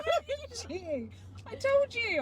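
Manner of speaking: high-pitched protest through laughter; embarrassed laughing outburst. Real, not acted. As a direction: protest while cracking up. Teased over failed accent, laughing protest.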